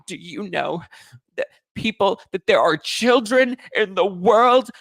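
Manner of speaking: Meredith Marks parody. Retold or imitated. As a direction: play the dramatic housewife moralizing. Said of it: "Meredith Marks on Salt Lake City".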